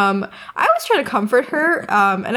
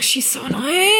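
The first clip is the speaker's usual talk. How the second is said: high, slow, exaggerated fed-up whine; mock annoyed; 9 semitones higher, slower than usual. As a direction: whine about a chatty best friend. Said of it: "I was like".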